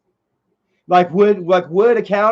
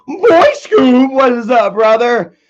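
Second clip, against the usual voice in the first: loud greeting; 4 semitones higher, 7 dB louder, slower than usual. Real, not acted. Streamer hyping up a viewer by name, loud shout.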